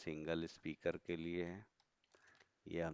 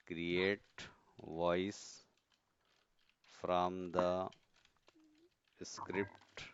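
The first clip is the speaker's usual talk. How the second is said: measured word by word, pausing between chunks; slow dictation; much slower than usual. Real, not acted. Teacher noting step two for students, slow dictation. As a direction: dictate it slowly as you write.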